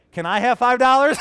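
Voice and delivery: mock preschooler's request; imitated four-year-old. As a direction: pipe up like an eager small child. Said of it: "four years old, she's like".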